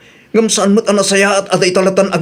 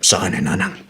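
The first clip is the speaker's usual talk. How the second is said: hushed plea; 13 semitones lower, 7 dB quieter than usual. Acted. Husband quietly pleading with mother.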